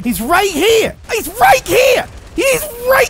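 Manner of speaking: high, loud panic; panicked warning. Real, not acted. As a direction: escalate in alarm with each repeat. Warning of enemy, escalating.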